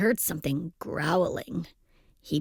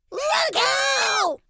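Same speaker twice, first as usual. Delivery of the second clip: very high, squealed warning; panicked shout. A narrator's reading. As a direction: shriek the warning in alarm. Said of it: "they shouted".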